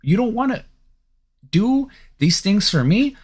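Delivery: imagined star player's fed-up challenge, pitched high; pretend ultimatum. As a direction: push the voice way up like you're calling their bluff. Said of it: "'cause you can be like".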